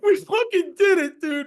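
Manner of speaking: high, loud, drawn-out mock wail; acted-out emotional victory cry; overwhelmed celebration. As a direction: re-enact bawling with joy down the phone. Retold tearful Super Bowl celebration call.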